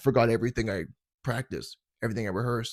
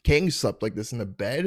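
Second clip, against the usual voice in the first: usual speaking voice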